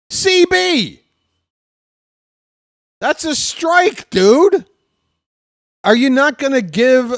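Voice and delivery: loud high indignant complaint; yelling at the umpire. Real, not acted. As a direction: chew out the ump, loud.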